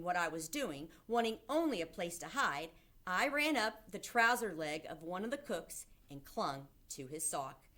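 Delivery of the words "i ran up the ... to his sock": plain reading voice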